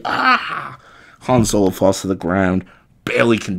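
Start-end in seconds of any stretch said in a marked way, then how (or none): 0.0-0.8 high mock scream of pain; imitated agony scream. Retelling the villain shot in the face, mock agony scream. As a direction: let out a high, hammed-up howl. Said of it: "He's just screaming in agony".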